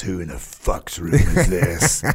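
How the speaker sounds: slow, low, imitated outrage; mock outraged sergeant major. Sergeant major opening the trashed room, slow and low. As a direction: drag it out low, like a scowling boss.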